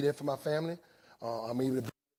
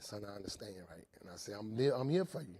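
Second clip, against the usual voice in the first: much softer, lower fatherly comfort; quiet sincere dad; tender reassurance; 6 semitones lower, 8 dB quieter than usual. Retold or imitated. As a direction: reassure his son gently.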